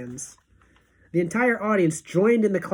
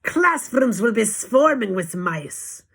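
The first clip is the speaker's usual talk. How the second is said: raised, slowed witch proclamation; witch's shout; 4 semitones higher, 4 dB louder, slower than usual. Acted. Grand High Witch to her witches, slow gloating shout. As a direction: crow it out like a gleeful witch. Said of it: "shouted the Grand High Witch".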